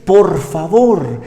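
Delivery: Jesus begging the Father to send the Spirit; Jesus pleading. Retold or imitated. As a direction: beg the Father tenderly for the Spirit. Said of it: "y le dice".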